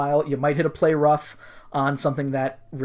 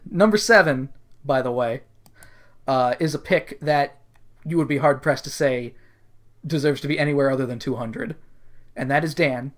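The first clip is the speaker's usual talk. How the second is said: ordinary talk, nothing marked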